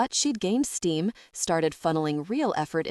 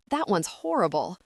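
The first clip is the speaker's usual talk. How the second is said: complaining. Acted. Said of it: "Lark complained".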